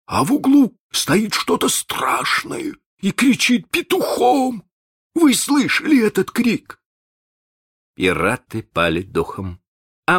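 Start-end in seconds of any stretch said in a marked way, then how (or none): 0.1-6.8 high, scared pirate voice; frightened pirate. Acted. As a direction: voice him rattled by the noise. Frightened pirate tells of horror.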